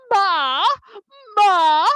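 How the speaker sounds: Ma said as a short open mah; a loud puzzled Ma, Hebrew for what. Retold or imitated. The people ask what the flaky stuff is, saying Ma loudly. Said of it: "so it sounded more like".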